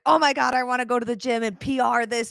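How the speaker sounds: amped-up excitement. Retold or imitated. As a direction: imitate a hyped fan bursting to train. Voicing an amped-up viewer's reaction.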